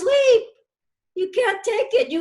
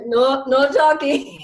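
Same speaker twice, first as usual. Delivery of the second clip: laughing; 6 dB louder than usual. Retold or imitated. Lady laughing through silence.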